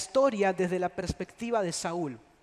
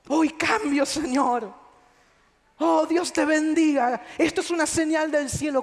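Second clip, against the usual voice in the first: higher, louder, overdone repentance; crocodile tears; 4 semitones higher, 4 dB louder than usual. Retold or imitated. Insincere penitent praying, in a mock dramatic voice. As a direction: ham up fake contrite emotion. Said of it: "lágrimas de cocodrilo".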